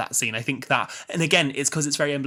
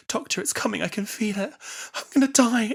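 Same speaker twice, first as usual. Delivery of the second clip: high, mock-panicked plea; emotional Amy; 10 semitones higher than usual. Amy pleading to the Doctor on the brink of death, emotional. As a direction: act out dread, pitched well up. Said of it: "she's very, like, emotional".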